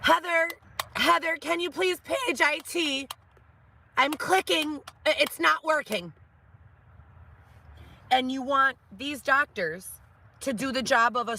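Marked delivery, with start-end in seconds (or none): 0.0-6.1 high, whiny pleading doctor; helpless doctor voice. Retold or imitated. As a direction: whine like a lost doctor. Doctor voiced whining to assistant.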